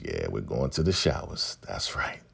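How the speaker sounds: low, hushed whisper; sly whispered aside. Real, not acted. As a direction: whisper it like a private aside to viewers. Suggestive aside about the showers.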